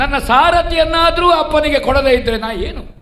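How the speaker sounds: loud, high theatrical protest; impassioned declamation. Acted. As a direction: proclaim it with full stage force. Prince protests in grand filial devotion.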